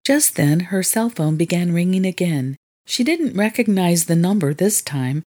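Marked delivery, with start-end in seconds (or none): none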